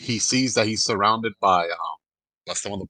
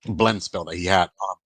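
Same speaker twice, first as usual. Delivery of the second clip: ordinary delivery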